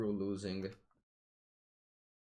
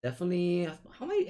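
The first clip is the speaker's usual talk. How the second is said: high squeaky voice; 9 semitones higher, 4 dB louder, faster than usual. Pretend. High repeat.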